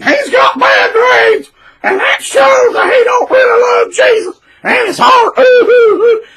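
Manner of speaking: high, loud, hammed-up shrieking voice; over-the-top mocking of the wife's post; mock shrill gossip.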